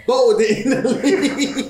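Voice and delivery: high, slowed, laughing through it. Real, not acted. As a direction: giggle through the words.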